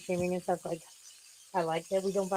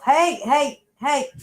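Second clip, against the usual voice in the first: loud sharp hey, much louder; shouting at cats; 6 semitones higher, 10 dB louder, much slower than usual. Real, not acted. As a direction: yell to break up the fight. Breaking up fighting cats.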